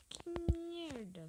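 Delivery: slow high frustrated curse; drawn-out curse. Real, not acted. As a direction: groan it out in frustration. Frustrated at the game.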